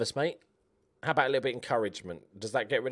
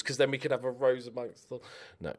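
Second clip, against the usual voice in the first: ordinary talk, nothing marked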